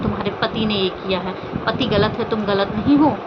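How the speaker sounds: ordinary delivery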